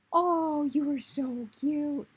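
loud high "oh" easing into a slow coo; adoring coo. Real, not acted. Gamer admiring the pink honey largo, adoring coo. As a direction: melt over a cute pet, drawn out.

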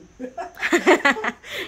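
high, loud burst of laughter; laughing. Real, not acted. Mum laughing at her homemade cendol mould, amused.

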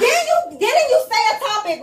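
high, loud, indignant challenge; indignant protest. Real, not acted. Protesting being talked about.

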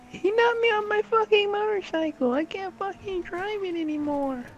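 put-on squeaky child voice howling about his motorcycle; whiny kid. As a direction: play a three-year-old throwing a tantrum. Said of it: "He's like".

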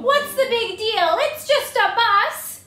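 high, loud, slow whine; exasperated pigeon. Acted. Pigeon protesting to the kids, high loud whine. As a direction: whine that it's no big deal.

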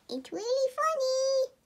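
high, bright excited plug. Real, not acted. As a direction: sell it with a high squeal.

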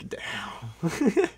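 がっかりしたささやき; whispered dismay. Retold or imitated. Facing mom's disappointment.

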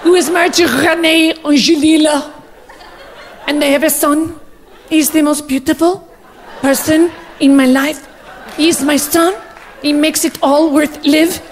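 hammed-up French-Canadian accent; halting French-accented English of a doting diva mother. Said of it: "Je suis [name]".

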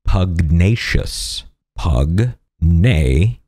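Pugnacious drawn out very slowly, each syllable separate. Shown as a demonstration. Host teaching the word of the day, very slow. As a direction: spell Pugnacious out slowly for the viewer. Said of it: "The word of the day is pugnacious".

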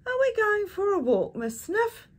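put-on high, eager little girl's question; excited child. A narrator's reading. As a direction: ask brightly like a small child.

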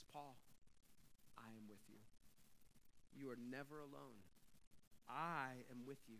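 hushed and soft, the voice of God close in his ear; God's whisper. Acted. God whispering comfort to Paul in a hushed voice. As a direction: drop to a tender near-whisper. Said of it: "God whispers in his ear".

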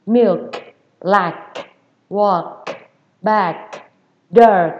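word list with throaty K releases; strongly hawked final K. Shown as a demonstration. Teacher repeating the list for students, hawked K. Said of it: "khạc mạnh cái âm cổ mình ra".

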